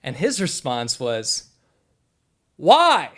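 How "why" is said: loud, high, baffled call back; shouted puzzled why. Retold or imitated. Distant fisherman calling back a loud puzzled why. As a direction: fling a confused why across the river.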